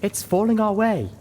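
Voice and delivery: high, loud, frightened warning; whimpering alarm. A narrator's reading. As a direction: warn your friends in rising panic. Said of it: "Velvet whimpered".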